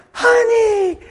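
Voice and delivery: very high, loud mock wail; imitated gushing wife. Husband imitating his wife after the accident, wailed gushing. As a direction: wail it fondly like an overcome wife. Imitating wife's emotional hug.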